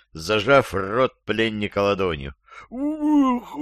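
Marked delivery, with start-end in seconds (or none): 2.7-3.6 very high smothered yelp; muffled cry. A narrator's reading. Captive kicked in the knee, high muffled cry. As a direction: cry out under a covering hand. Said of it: "невнятно закричал Шустрый".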